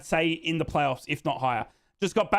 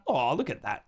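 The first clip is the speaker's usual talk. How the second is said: normal talking voice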